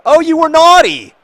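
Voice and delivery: high, loud teasing squeal of naughty; mock scolding. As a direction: shriek a playful telling-off at the player. Scolding a player in the game.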